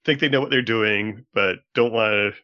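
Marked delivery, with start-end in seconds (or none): none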